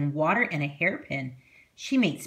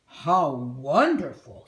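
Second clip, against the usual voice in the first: put-on slow, amazed young man; Big Anthony voice; 4 semitones lower, slower than usual. A narrator's reading. Big Anthony marvels at the magic pot, slow and amazed. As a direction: draw out the wonder.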